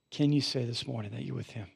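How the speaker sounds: usual speaking voice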